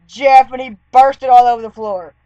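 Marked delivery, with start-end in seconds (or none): 0.1-0.5 throwing call. Retold or imitated. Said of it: "I go".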